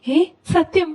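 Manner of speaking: high-pitched, thrilled disbelief; excited can-it-be-true question; delighted surprise. Acted. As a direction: jump up in pitch, overjoyed. Son learns he gets bike.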